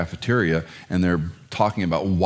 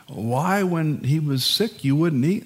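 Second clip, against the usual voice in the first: sheepish servants; 7 semitones higher, slower than usual. Retold or imitated. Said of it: "ask him sheepishly".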